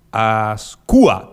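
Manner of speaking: "Ascua" with the U accented; stress on the U. Shown as a demonstration. Reading out listener Mati's surname, stress on the U. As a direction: lean firmly on the U of "Ascua". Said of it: "con acento en la U".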